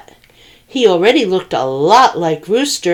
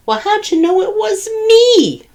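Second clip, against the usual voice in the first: groaning turkey. A narrator's reading. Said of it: "groaned Turkey".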